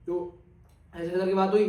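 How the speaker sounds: normal talking voice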